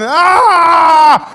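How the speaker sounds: long, loud, high wail; put-on scream of pain; mock cry on cue. Shown as a demonstration. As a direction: wail the moment the stick lands. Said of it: "As it hit a bar".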